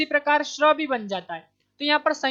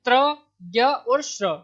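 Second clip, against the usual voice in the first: त्र, ज्ञ और श्र spelled out slowly, each letter apart; slow listing; 4 dB louder, slower than usual. Shown as a demonstration. Teacher names the joined consonants for students, slowly. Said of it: "क्या होता है".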